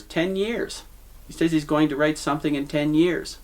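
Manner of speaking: put-on a half-hearted Irish accent, high and sneering; attempted Irish accent. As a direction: sneer it like Mulligan in a weak Irish lilt. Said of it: "I can't do an Irish accent".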